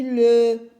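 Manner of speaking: "le" loud, with a long L and held vowel; syllable sounded out. Shown as a demonstration. Teacher contrasting syllables for children, "le" loud and stretched. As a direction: stretch "le" loudly so each sound shows. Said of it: "pour entendre le".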